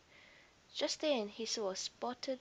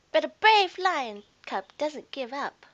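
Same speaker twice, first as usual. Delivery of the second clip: loud, higher determined cry; brave cub; 5 semitones higher, 14 dB louder than usual. A narrator's reading. Faetua pushing himself on through the grass, loud and determined. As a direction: puff up your courage.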